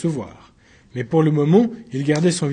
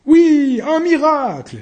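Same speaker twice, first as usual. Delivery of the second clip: very high, loud exclamation; outcry; 11 semitones higher, 6 dB louder, slower than usual. Acted. Uncle Pierre Guerre proclaiming a miracle, loud high shout. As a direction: seize the word and proclaim it. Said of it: "s'écria Pierre Guerre".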